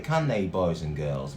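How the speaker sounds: plain reading voice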